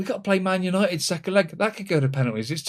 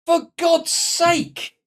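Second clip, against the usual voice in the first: loud, slow, high cry of frustration; fed up at a bad choice; exasperated outburst; 12 semitones higher, 5 dB louder, much slower than usual. Real, not acted. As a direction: drag it out in real exasperation. Angry at the penalty taker choice.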